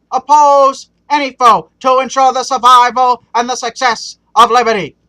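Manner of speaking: put-on very loud, oratorical Boston accent; President Kennedy's Boston accent. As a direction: belt out a speech in a bad Boston accent. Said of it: "pardon my terrible Boston accent there".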